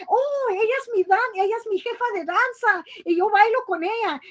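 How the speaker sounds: high-pitched, excited retold outburst; all excited. Dancer telling booksellers that's her dance teacher, all excited. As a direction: burst out as if spotting someone you adore. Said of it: "I got all excited and I said".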